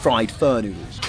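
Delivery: ordinary talk, nothing marked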